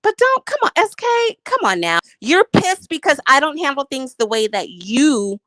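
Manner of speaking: ordinary talk, nothing marked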